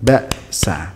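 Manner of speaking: "Baksa" cut after ba, a beat of silence, then sa; one-beat glottal stop. Shown as a demonstration. Teacher demonstrates hamzah, a clean gap splitting "Baksa". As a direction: stop the voice sharply inside "Baksa". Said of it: "istilahkan itu sebagai jeda".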